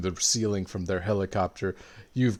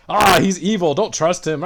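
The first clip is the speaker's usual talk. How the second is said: higher and louder hammed-up warning; frantic accusation; 8 semitones higher, 8 dB louder than usual. Amoeba accusing Silver Scar in the bank, hammed-up yell. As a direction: yell it to turn the crowd. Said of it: "Amoeba was like".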